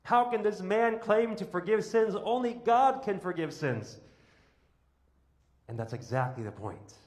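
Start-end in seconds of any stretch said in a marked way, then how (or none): 0.0-4.0 scribes crying out. Retold or imitated. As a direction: cry out like scandalised scribes. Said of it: "cry out".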